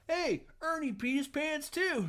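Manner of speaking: put-on squeaky kid calling it out; little kid voice. Same kid voiced again.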